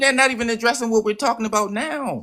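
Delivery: high-pitched exasperated complaint; real frustration, voice pushed up high. Real, not acted. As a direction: protest at being sidetracked, pitch climbing. Exasperated at being sidetracked.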